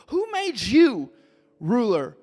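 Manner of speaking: high, slow sneering challenge; mocking Hebrew man. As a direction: throw it back at him, slow and high. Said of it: "the man said".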